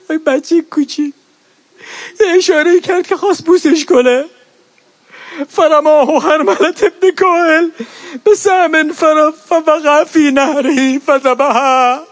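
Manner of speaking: اوج روضه، بلند و زیر، با بغض و گریه. Acted. (مداح در اوج روضه برای عزاداران، بلند و گریان)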